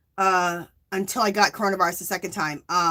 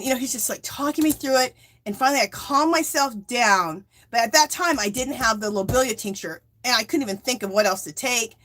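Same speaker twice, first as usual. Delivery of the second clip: ordinary talk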